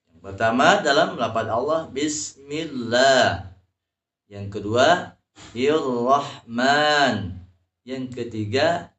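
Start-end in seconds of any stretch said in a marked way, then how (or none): 1.9-3.6 Bismillah slowly with the L held; held doubled L in Bismillah. Shown as a demonstration. Teacher showing the first syiddah in Bismillah, slow held L. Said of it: "menahan suara". 5.5-7.4 Ir-Rahman slow, pressing on the R; held doubled R in Ir-Rahman. Shown as a demonstration. Teacher showing the second syiddah, slow held R in Ir-Rahman. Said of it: "menahan suara".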